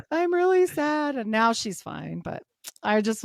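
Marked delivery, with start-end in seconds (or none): 0.1-1.2 high, hammed-up sad whine; mock sad girl. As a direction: whimper it like a pouting kid. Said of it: "she's like".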